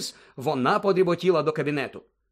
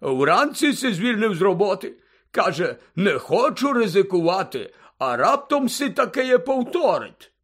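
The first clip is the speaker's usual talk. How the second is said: tipsy Hagrid, explaining; 6 semitones higher, 4 dB louder than usual. A narrator's reading. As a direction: relay the news like a drunk big friend. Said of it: "здивувався Гегрід".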